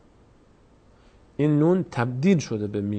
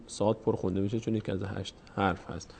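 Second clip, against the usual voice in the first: ordinary talk, nothing marked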